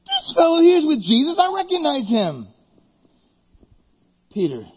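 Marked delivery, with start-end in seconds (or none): 0.0-2.5 put-on accusing servant girl. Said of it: "then she said".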